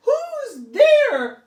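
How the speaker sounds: high, loud who's there; calling out. Acted. Olive calls out to the growling bear, loud and high. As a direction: call into the darkness, startled. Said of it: "called Olive".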